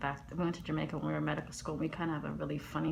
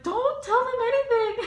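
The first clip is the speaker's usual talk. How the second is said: much higher, laughing mock voice of the scammer; panicked whisper-warning; 8 dB louder than usual. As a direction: squeak it out urgently through a laugh. Scammer warns on phone.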